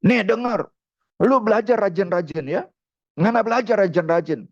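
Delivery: grudging promise through annoyance; annoyed. Retold or imitated. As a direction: talk to the son with acted exasperation. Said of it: "dengan kesel sebetulnya".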